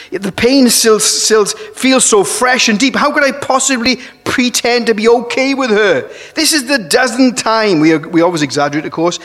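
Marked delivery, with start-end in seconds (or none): 0.1-7.8 higher-pitched, hurt complaint to oneself; acted wounded indignation; aggrieved protest. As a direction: plead your grievance, voice raised in pitch. Voicing a hurt person's complaint.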